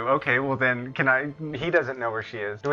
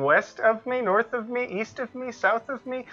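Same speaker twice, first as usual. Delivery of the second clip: chick voice; 8 semitones higher than usual. Acted. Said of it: "Try to go with the chick voice".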